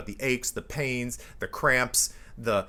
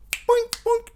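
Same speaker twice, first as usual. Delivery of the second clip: very high, loud springy boinks; squeaky-high bouncing noise; cartoon boink; 7 dB louder than usual. Shown as a demonstration. Imitated noise of bouncing back.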